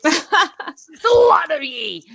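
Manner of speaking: slow, high, loud Irish shout through laughter; mock angry Irish. Telling friends what she yells when mad, a laughing Irish shout. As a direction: bellow it like a furious Cork woman. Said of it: "when I really get mad".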